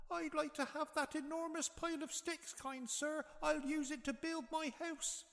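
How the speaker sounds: very high squeaky little pig voice; a tiny polite pig asking sweetly; second little pig. A narrator's reading. Second pig asking the farmer, very high little voice. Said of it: "So the second little pig said to the farmer".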